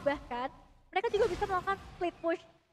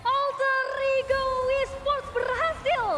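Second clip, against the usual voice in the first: shouting announcer; 8 semitones higher, 6 dB louder than usual. Real, not acted. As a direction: belt out the result to the crowd. Co-caster shouting the result.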